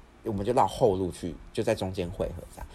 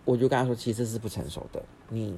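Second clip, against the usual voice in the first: ordinary delivery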